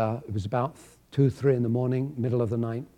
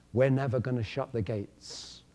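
usual speaking voice

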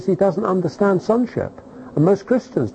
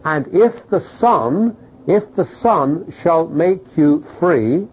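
slow scripture quote; slower than usual. Acted.

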